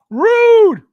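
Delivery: high loud yelp of mock offence; mock outrage. Real, not acted. Streamer playfully yelping at a viewer's name, high and loud. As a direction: shriek it like a comic insult.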